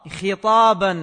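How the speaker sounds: خطابا said loudly as khitaaban, i after خ; kasra on خ. Shown as a demonstration. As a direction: punch out خطابا with a short i on the خ. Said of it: "بكسرها".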